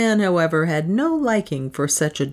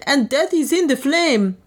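terrified cry; 8 semitones higher than usual. A narrator's reading. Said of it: "one cried".

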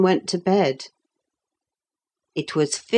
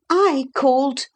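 put-on high-pitched, awed boy; slightly trembling; 9 semitones higher than usual. A narrator's reading. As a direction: answer the great figure in a high, nervous voice. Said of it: "in a voice that trembled a little".

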